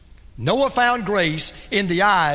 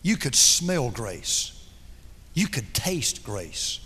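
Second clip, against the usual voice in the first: hushed reverence; 9 semitones lower, 6 dB quieter, slower than usual. Real, not acted. Soft reverent build.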